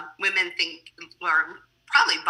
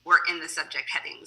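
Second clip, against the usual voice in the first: normal talking voice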